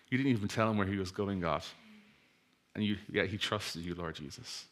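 soft, hushed prayer voice. Real, not acted. Preacher prays quietly before the study, hushed. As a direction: pray softly over the congregation.

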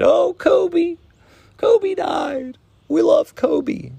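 lampoon the crowd pretending to be heartbroken; mocking fake mourners. Mocking fans who claimed to love Kobe, in a high wailing whine. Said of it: "all the people that were like".

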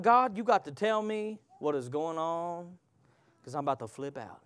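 low, soft, fading exasperation; hushed mock exasperation; frustrated prayer. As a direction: let it drop to a muttered plea.

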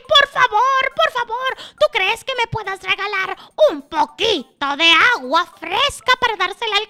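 high, breathless-running bird plea; pleading magpie. Acted. Magpie begging the water carrier for water, high pleading. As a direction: beg in a high little voice, spilling out the list.

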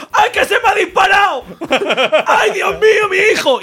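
hammed-up panic. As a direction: shriek like a fake-guilty parent.

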